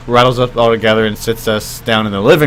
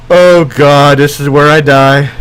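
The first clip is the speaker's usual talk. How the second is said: louder mock-panicked exclamation; retold dread; 2 semitones higher, 6 dB louder, slower than usual. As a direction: act out doom as if about to die. Dreading the grandma's outburst.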